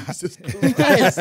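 mock awe, slow and broken up by laughter; laughing exclamation. Guest retells his player rating, laughing and amazed. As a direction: laugh it out as you quote yourself. Laughing while quoting own reaction.

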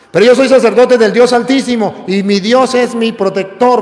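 proclamación fuerte, más aguda; grítalo desde el púlpito con convicción; predicación enfática. Acted. (predicador proclamando con fuerza y algo más agudo)

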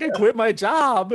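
high, loud, drawn-out wail of alarm; hammed-up panicked outcry; mock panic. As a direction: retell a freak-out at full pitch. Retelling his panic, repeated louder.

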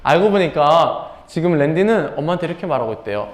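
ordinary talk, nothing marked

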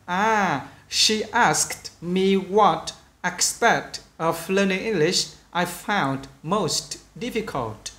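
slow, measured English dialogue read-aloud. Shown as a demonstration. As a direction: read slowly with pauses between phrases for learners. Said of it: "cũng chậm thôi".

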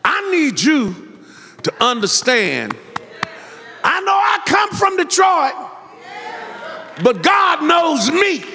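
tune it up and holler to the church; full-force preaching. Acted. Preacher hollering to his congregation about God's freedom.

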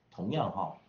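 normal talking voice

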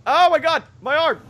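loud, very high yelps; mock panic. Gamer whose character loses an arm, mock panicked yelps. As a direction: yelp it in rising panic. Game character losing limb.

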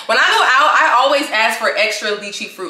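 ordinary talk, nothing marked

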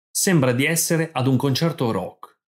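ordinary talk

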